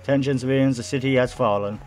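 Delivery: high, projected public announcement; radio announcer. Acted. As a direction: announce it like an emergency bulletin. Reads radio broadcast in game.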